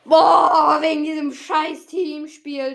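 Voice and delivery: loud, high frustrated rant; angry outburst. Real, not acted. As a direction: vent your anger loudly. Losing team game.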